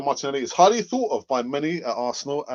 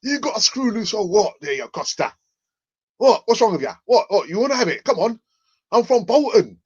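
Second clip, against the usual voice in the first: mock aggressive Bolton accent, higher and louder; Bolton accent attempt; 7 semitones higher, 4 dB louder than usual. Defender squaring up to Costa, failed Bolton accent. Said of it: "I can't do the accent".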